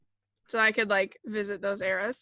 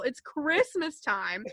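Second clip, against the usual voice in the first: ordinary talk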